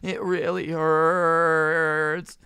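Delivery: put-on very slow, drawn-out whiny kid; slow mock whine. Spoiled kid whining to his mom for sympathy. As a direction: stretch it out like a spoiled kid milking it. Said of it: "the kid starts being like".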